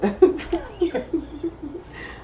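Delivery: bright burst of laughter; high laugh. Real, not acted. Laughing at her own point.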